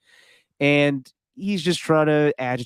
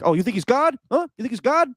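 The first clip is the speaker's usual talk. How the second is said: bad Jimbo Fisher impression; 11 semitones higher than usual. Co-host mocks a coach scolding his mentor, botched accent. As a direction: sneer it like a riled-up coach. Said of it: "I don't do a good Jimbo Fisher".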